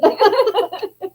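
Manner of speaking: laugh. Real, not acted.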